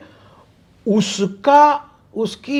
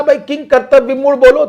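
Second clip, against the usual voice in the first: teasing challenge; 6 semitones higher, faster than usual. Retold or imitated. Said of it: "मैंने उनसे कहा".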